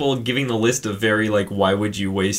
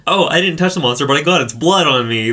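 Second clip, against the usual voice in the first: loud, high oblivious dad voice; dumb dad; 6 semitones higher, 7 dB louder than usual. Retold or imitated. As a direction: blurt it out loudly like a clueless dad. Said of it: "the dumb Yuthik dad is just like".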